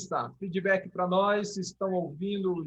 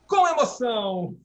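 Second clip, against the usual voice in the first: playful exclamation; 5 semitones higher, 8 dB louder than usual. Pretend. Relief after fixing the audio.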